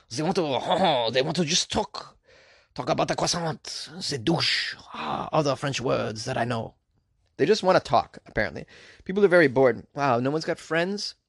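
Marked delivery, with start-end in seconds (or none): 0.1-6.7 exaggerated French accent with drawn-out words; comic Frenchman, heavy French accent. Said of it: "Is that a pretty good French accent?".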